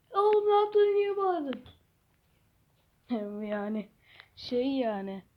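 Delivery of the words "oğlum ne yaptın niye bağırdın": higher, softer scolding. Pretend. Kid hushing his friend for shouting. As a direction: rebuke him quietly.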